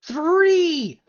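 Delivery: loud, high drawn-out exclamation; incredulous outburst. Real, not acted. Viewer hears of the third drunk-driving charge, incredulous. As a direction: blurt it out in loud disbelief.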